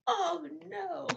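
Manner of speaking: high, mock-dismayed little wail; hammed-up "uh-oh" of a toddler; acted falling kid. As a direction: play a kid's tumble in a put-on high voice. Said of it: "The kid, Charlie falls".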